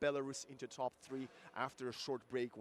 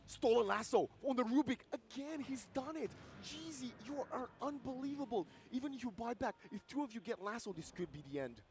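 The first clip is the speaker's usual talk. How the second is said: fast, high-energy esports casting; excited play-by-play; 10 semitones higher, 4 dB louder than usual. Acted. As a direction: call the action with hype.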